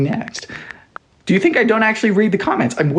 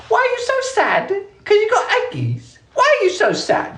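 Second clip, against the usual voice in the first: very high squeaky voice; playful high-pitched fussing; teasing mock baby talk. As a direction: coo it in a silly falsetto. Teasing reply to a sad commenter.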